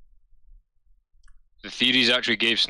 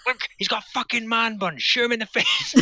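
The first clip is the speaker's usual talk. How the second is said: mock outraged yell; 14 semitones higher than usual. Wife spotting a henchman's man bun, high laughing yell. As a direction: screech it like the man bun is a personal insult. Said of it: "he's like".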